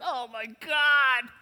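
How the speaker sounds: loud, high, drawn-out mock amazement; hammed-up dumbstruck guy; awestruck exclamation. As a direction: cry it out slowly, overwhelmed. Acts an awestruck man gawping.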